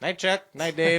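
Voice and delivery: mock goodnight calls. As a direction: call goodnight round a pretend household.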